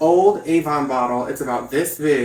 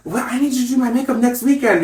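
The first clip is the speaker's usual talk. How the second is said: imitated Spanish lady; 8 semitones higher, 4 dB louder than usual. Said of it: "they would be like".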